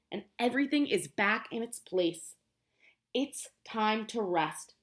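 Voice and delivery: slow, measured read-aloud of the book text; storybook reading. Acted. Teacher reading to the class, slow and measured. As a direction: read each line slowly, setting things in their place.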